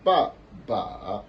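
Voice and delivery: first ba falls, second ba dips and rises; ba ba, falling then dipping; third tone on second syllable. Shown as a demonstration. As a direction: drop "ba", then bend the next "ba" down and up. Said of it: "Celui-là, ça va donner".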